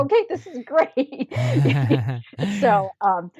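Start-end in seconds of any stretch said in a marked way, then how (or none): 0.0-1.3 high, thrilled quote; delighted. Retold or imitated. Traveller accepting the car ride, high and delighted. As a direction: sound overjoyed. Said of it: "I was like".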